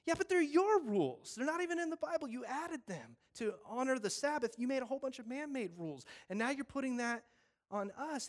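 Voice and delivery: high, loud, worked-up rebuttal; fired-up protest. Retold or imitated. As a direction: Steve pushes back at the rule-makers. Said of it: "be Steve and be like".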